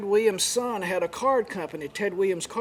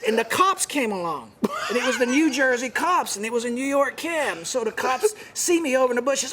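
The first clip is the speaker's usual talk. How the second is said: usual speaking voice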